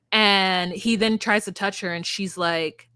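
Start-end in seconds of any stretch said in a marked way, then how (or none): none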